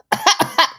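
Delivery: sudden sputtering cough; choking on a drink. Real, not acted. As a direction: cough hard, wine down the wrong pipe. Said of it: "I'm choking on my wine".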